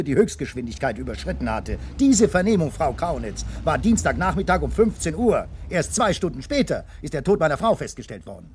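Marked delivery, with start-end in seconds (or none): 2.0-8.5 sharply raised, louder protest; insisting on his alibi, voice raised and indignant; indignant rebuttal. Acted. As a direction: hit back hard at her accusation. Angrily refuting the witness's accusation.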